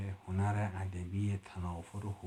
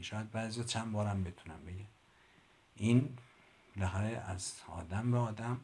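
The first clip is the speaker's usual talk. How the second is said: ordinary delivery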